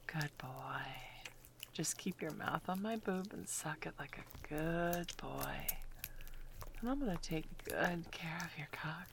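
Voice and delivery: steady hushed whisper. Acted. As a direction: murmur close and intimate.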